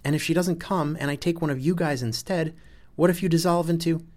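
loud, high-pitched worry; agitated. A narrator's reading. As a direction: argue it with rising alarm. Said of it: "Sol gets loud when he's agitated".

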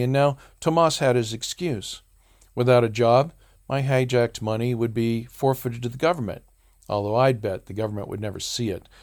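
plain reading voice

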